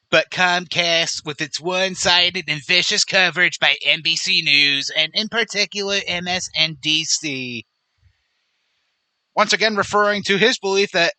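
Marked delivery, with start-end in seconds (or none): 0.1-7.6 Donald Trump. Acted. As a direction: read the post as a mock presidential impersonation. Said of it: "But he continues".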